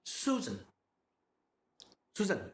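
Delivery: "Susan" quick, first vowel short; no emphasis. Shown as a demonstration. Teacher showing the unemphasized name, "Susan" said quickly. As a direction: toss off "Susan" short, nothing held. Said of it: "so much of a difference".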